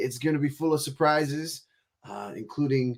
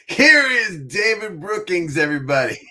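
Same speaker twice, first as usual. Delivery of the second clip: announcer intro; 7 semitones higher, 6 dB louder than usual. Real, not acted. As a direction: bring the guest on like a showman. Host introduces guest.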